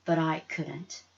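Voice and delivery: ordinary delivery, nothing marked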